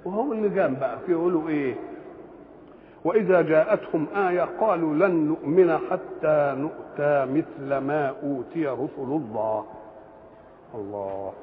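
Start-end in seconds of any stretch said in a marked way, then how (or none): 3.0-11.3 slow, measured recitation with long drawn vowels; Quran recitation. Acted. Preacher quotes a verse to his audience, slow measured recitation.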